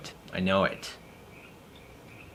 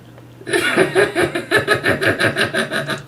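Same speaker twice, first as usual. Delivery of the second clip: high, creepy airless cackle; evil clown laugh; 4 dB louder than usual. Acted. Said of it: "We need to find [name]'s evil clown".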